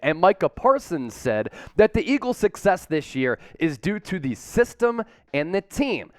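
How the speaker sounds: ordinary delivery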